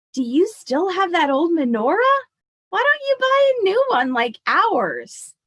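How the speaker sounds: high, loud, dismissive mother; mother's voice. Acted. Mother urges Grandma to buy a new menorah, high, loud and dismissive. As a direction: push Grandma to replace the old one, pitched high.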